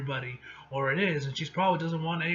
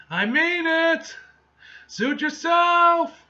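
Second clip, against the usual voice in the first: slow, much higher and louder yelled call; angry shout; 14 semitones higher, 10 dB louder, much slower than usual. Acted. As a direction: bellow the ultimatum down the stairs. Character yells up the stairs.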